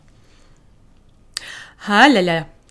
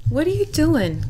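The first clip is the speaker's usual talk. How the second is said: high pet talk to cat; 8 semitones higher than usual. Real, not acted. Talking to the cat.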